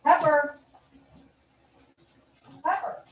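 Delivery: very high pet-calling voice; real searching call, high; calling the dog. Real, not acted. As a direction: call the dog's name, pitched right up. Still calling the missing dog.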